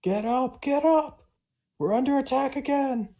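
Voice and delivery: high, urgent shout; alarm yell. Acted. Watchman wakes the party under attack, high-pitched yell. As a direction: yell it out to rouse the sleepers. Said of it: "I'm gonna yell".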